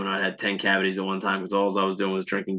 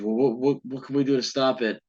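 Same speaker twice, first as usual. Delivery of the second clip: higher, stammering mock panic; mildly panicked, pleading imitation; imitated freak-out; 4 semitones higher than usual. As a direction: play a friend starting to panic. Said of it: "he starts freaking out".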